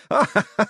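high burst of mad laughter; manic, horrified giggling fit; hysterical laughter. A narrator's reading. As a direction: cackle in helpless panic. Hubert has hysterics.

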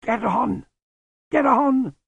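high, rising urgent push. Acted. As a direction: urge him on desperately. Lutzer urging, dying.

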